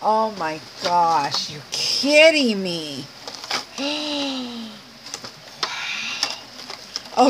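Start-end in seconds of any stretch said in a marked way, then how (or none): 3.8-7.3 breathless hushed wow under the breath; awed whisper. Real, not acted. Unboxing a friend's gift beads, an awed whisper. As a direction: let the wow slip out as a whisper.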